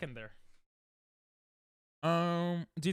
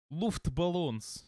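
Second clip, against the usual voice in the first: mock Russian accent; much slower than usual. Streamer joking about the German song, mock Russian accent. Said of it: "I don't know why I did a Russian accent".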